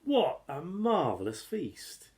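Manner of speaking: ordinary delivery, nothing marked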